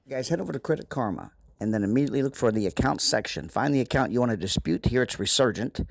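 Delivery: walk them through each click; instructional voiceover. Acted. Screen walkthrough voiceover.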